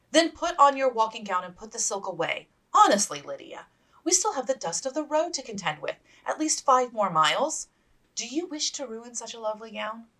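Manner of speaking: a hint of a stern older sister; lightly voiced stern sister. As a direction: set her straight firmly. Elizabeth lecturing sister.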